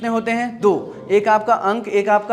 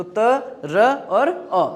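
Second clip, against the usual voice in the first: slow spelling out; much slower than usual. Shown as a demonstration. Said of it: "spelling out the parts".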